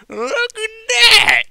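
put-on very high, loud drunken cry; shouted. A narrator's reading. Applejack shouting at the night sky, drunk and delighted. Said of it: "suddenly shouted".

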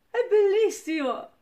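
loud, high burst of delight; thrilled exclamation; excited. Real, not acted. Delighted at the sight.

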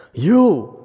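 loud, high indignant rebuke; upset sage. Retold or imitated. Sixth patriarch rebuking a slanderer, loud and outraged. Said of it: "Chinese sage being upset".

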